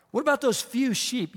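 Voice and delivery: high-pitched mocking dig; retold jab. Oldest brother belittling David's work, acted needling. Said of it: "Here's a jab".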